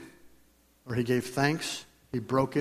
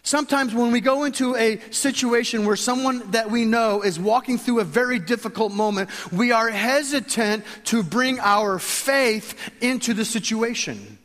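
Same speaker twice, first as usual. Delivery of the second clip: high, loud passionate preaching; 8 semitones higher, 4 dB louder than usual. Real, not acted. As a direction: preach it with rising fervor. Preaching builds to full force.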